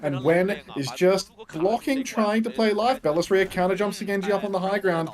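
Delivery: excited esports casting; play-by-play. Acted. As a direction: call the fight live.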